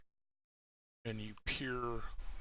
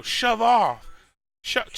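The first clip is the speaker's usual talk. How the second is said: put-on higher, louder brush-off, accent held back; snappy halfling girl; 10 semitones higher, 4 dB louder, slower than usual. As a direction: tell him to shove off sharply. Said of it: "funny accent that I'm not gonna try and do".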